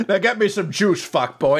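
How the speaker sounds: high loud put-on voice breaking into laughs; laughing mock bully. [name] ordering Phil, laughing high put-on voice. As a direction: boss him around while cracking up.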